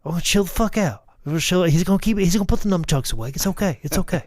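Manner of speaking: high, quick and excited talking-down; giddy mock soothing. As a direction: hype him up while calming him. Continuing joke quote, high.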